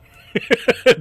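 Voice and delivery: loud squealing laugh, real; high burst of laughter. Real, not acted. Laughing at angry teammates.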